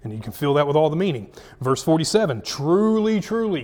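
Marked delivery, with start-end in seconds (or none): 2.4-3.6 "Truly, truly" pitched high, first one loud; emphatic call to attention. Acted. Jesus telling the guys not to miss this, emphatic "Truly, truly". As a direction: hit "Truly, truly" high and drawn out. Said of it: "don't miss what I'm about to tell you".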